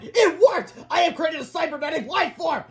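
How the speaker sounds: high-pitched inventor's proclamation; triumphant shout. A narrator's reading. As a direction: exult at the top of the voice. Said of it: "shouted Melvin".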